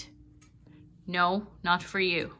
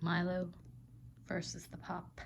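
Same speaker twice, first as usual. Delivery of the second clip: hushed play-by-play whisper; soft mock match commentary; 3 semitones lower, 13 dB quieter than usual. Real, not acted. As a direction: whisper it like a showdown.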